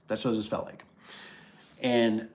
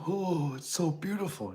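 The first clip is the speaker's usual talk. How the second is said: higher, drawn-out mock gushing; gushing woman; 8 semitones higher, slower than usual. Imitating women pulling up to the house, gushing. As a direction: swoon over it, slowly and high. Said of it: "the women. That's what they're thinking about".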